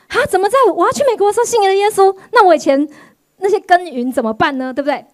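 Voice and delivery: high, fast exasperated protest; frustrated outcry. Retold or imitated. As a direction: complain in mock despair. Her own frustrated thought retold.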